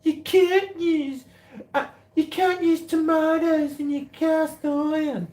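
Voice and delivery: very high, slow mocking whine; whiny mock scold about tomatoes in cast iron; mocking nagging critics. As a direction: mimic the know-alls in a squeaky nagging voice. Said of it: "people were getting up me".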